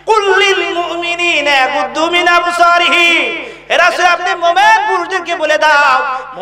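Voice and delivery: high, ringing rebuke in preaching voice; preacher imitating a sharp reprimand; scolding old woman. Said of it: "বুড়িমা ধমক দিয়ে বললেন".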